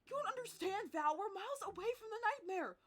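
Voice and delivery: loud, high, mounting distress; panicked protest. Acted. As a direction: argue back in a panic, rising at the end. Despairing that they'll be late.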